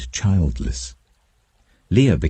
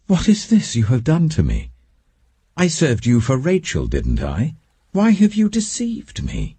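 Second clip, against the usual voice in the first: higher, indignant cry of a cheated man; indignant outcry; 9 semitones higher than usual. Acted. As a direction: protest the deception, pitched up. Jacob finds Leah, cheated.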